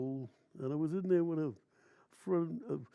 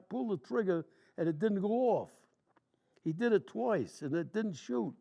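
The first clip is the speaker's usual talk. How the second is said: ordinary talk, nothing marked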